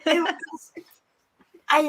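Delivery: usual speaking voice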